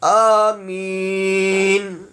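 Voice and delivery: slow melodic tilawat of Fatiha; Quran recitation. Acted. Imam recites Surah Fatiha as in prayer. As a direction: chant it slowly like in prayer.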